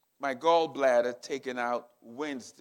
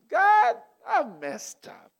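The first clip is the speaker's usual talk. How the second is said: higher, louder, slow anguished cry; crying out to God; 12 semitones higher, 5 dB louder, slower than usual. Retold or imitated. Pastor retelling his prayer, crying out loud to God. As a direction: cry it out as a desperate plea.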